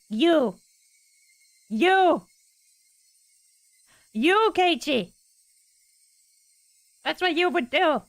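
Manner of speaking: high, loud yells of you, stretching the name; furious accusing shout. Acted. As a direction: shout at Keiichi, pointing him out. Character shouting accusation at Keiichi.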